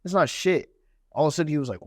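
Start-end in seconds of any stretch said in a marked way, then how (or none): 0.0-0.7 higher-pitched dismissive retort, mildly heated; retold anger. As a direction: act out scoffing back, pissed off. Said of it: "actually pissed".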